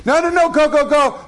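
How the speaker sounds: very high, singsong-like mock insistence; high-pitched retold "go on"; insistent urging. Acting wife urging husband to go.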